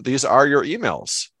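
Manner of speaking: ordinary delivery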